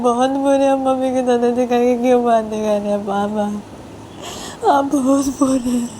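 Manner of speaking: put-on high, tearful little girl begging; high whining crying kid; crying child. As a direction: sob out for mummy.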